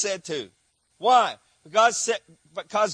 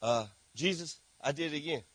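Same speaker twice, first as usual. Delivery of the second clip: sheepish sinner; 5 semitones lower, 9 dB quieter than usual. Acted. Sinner confessing again.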